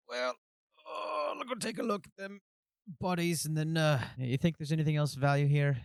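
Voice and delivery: high-pitched, put-on character voice; Osric in character. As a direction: start high and weary, then settle slightly. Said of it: "He shakes his head, stands up, says".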